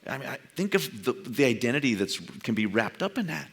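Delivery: ordinary talk, nothing marked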